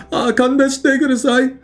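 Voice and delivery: high-pitched begging game character; defeated enemy pleading. Acted. Beaten video game enemy begs the player, high pleading voice. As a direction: plead for your life in a high put-on voice. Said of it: "You defeat the enemy and they say".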